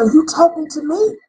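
taken aback, raise pitch and volume on the question; shocked. Retold or imitated. Hungry woman to the stranger offering food, shocked. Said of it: "like she was shocked".

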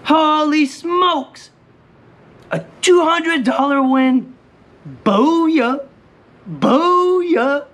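high celebratory holler; stretched, high shouts of triumph; excited yelling. Real, not acted. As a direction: yell it out, thrilled at the big win. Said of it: "I'm yelling at the camera".

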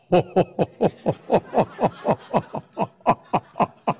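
long high laugh; a real amused laugh at himself. Real, not acted. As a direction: burst into laughter. Laughing at his own story.

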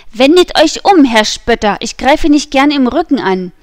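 loud and high, calling after him; enraged youth challenging a departing nobleman; furious challenge. A narrator's reading. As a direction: hurl the challenge at his back. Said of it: "Der ergrimmte Gascogner".

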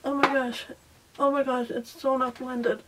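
normal talking voice

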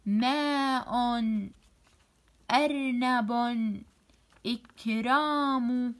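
slow, drawn-out words from "ماء" to "مسرعا"; measured word-by-word reading, "ماء" to "مسرعا"; syllables into words. Shown as a demonstration. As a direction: stretch each syllable of "إكرام" and "لوزا". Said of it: "أركب من المقاطع كلمات".

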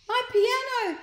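high, loud wail; anguished cry. Acted. Sloth wailing over her broken piano, high and loud. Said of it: "she cried".

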